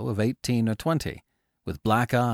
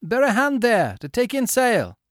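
high, loud order to the crew; ship's command; 14 semitones higher, 6 dB louder than usual. A narrator's reading. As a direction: call it across the deck.

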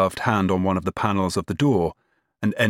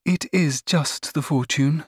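high, slow, sad admission; 8 semitones higher, slower than usual. A narrator's reading. Eldest sister owns it is the fortune, sadly. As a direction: admit it slowly and sadly. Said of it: "she said sadly".

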